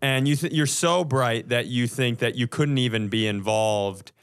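normal talking voice

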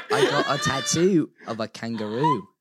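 Australian accent through laughter; laughing put-on Australian accent. Shown as a demonstration. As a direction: say it as a giggling Aussie. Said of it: "You did Australian".

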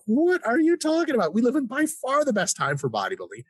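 high-pitched, incredulous rant; worked-up, high-pitched outburst; ranting disbelief. Real, not acted. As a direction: protest it high, as if it were absurd. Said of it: "sorry to rant".

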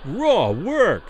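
lightly voiced piping heckler; understated shrill boyish jeer; shrill boy's voice. A narrator's reading. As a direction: pipe it out like a boy in the gallery. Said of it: "a shrill boy's voice from the gallery piped".

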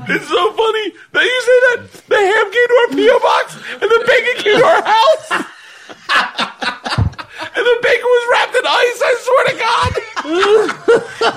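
high squeaky laughter through words; words squeezed out while cracking up; laughing hard. Real, not acted. Helpless laughter over the bacon.

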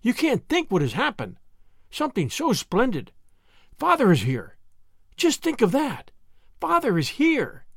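high-pitched, bubbling with news; eager boy's excited, lilting voice; excited child. A narrator's reading. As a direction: burst out with thrilling news. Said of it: "he cried excitedly".

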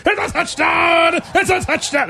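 high ringing announcer shout; touchdown call. Shown as a demonstration. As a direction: call it like a radio play-by-play. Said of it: "That's what I want".

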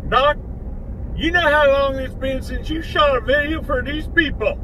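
lightly voiced folksy hillbilly sidekick; a hint of a country sidekick chiding the doc; hillbilly Bubba voice. Bubba chides the doctor for not posting, in a light hillbilly voice. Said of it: "him on camera doing the voice".

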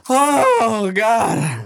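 high, choked-up cry on oh God; imitating himself breaking into tears; mock crying. Retelling crying at a TV episode, choked-up cry. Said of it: "I was like".

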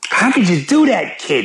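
put-on high-pitched over-the-top Boston accent. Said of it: "my horrendous Boston accent".

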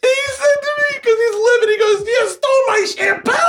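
can barely get it out for laughing; laughing high retelling. Real, not acted. Tells the hosts about the RV fight, cracking up. Retelling friend's furious tirade.

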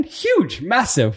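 high squeal of laughter; laughing. Real, not acted. Laughing about baggy pants.

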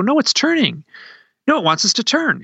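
high-pitched, urgent insisting; insistent wife. Retold or imitated. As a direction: imitate a wife excitedly correcting her husband. Wife insisting on following light.

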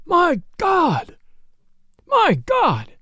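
high-pitched wail of horror; stricken, high exclamation; anguished cry. Acted. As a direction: cry out in despair, voice leaping high and falling. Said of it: "cried the sheriff".

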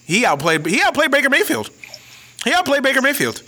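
OUTPLAYED punched high and hard, insisting; emphatic repeat. Real, not acted. To his co-host, insisting the freshman outplayed Baker Mayfield. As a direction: hammer the word outplayed, voice jumping up.

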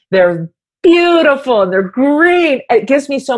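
beam about the green plants; delighted. Real, not acted. Proud of living plants.

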